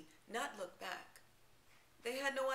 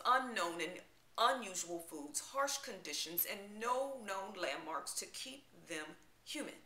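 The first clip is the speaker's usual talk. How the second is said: normal talking voice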